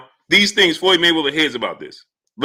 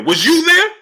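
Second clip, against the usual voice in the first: much higher and louder, rising on "there"; incredulous outburst; 12 semitones higher, 5 dB louder than usual. Real, not acted. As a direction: fire "Was you there?" back at him in disbelief. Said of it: "This dude said".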